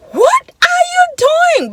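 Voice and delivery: high, drawn-out imitation of a scream; screamed retelling. Woman screaming at the driver who stopped her car, high mock scream. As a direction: retell her scream high and loud, stretching each word. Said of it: "this woman screaming".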